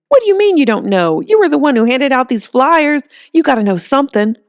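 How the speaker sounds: put-on an old mechanic demanding answers; demanding old man.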